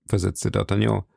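ordinary delivery

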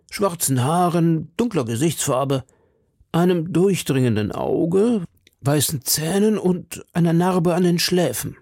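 high-pitched, earnest citizen; worried citizen. Acted. Husband describing the kidnapper to D'Artagnan, earnest citizen.

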